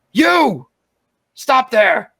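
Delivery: high, loud commanding shout; yell. Acted. Guard yelling at the informant mid-sentence, commanding. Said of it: "I'm gonna yell".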